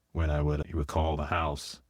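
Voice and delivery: normal talking voice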